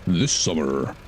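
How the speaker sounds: movie guy voice. Shown as a demonstration. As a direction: drop into the trailer announcer for two words. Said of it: "My attempt at a movie guy voice".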